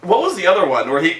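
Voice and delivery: normal talking voice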